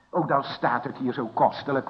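ordinary talk

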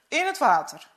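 usual speaking voice

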